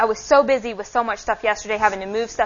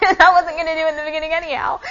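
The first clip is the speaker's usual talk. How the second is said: laughing through the words, quick and higher; a real laugh spilling into the line; 7 semitones higher, 4 dB louder, faster than usual. Real, not acted. Admitting to the congregation, laughing and quick. Laughs at her own reluctance.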